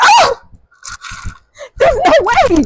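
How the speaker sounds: high shrieking disbelief, laughing through it; shocked disbelief. Retold or imitated. After getting pepper sprayed, shrieking high disbelief. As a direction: squeal it out in stunned shock. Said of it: "Bro said".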